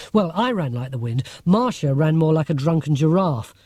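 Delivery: ordinary delivery, nothing marked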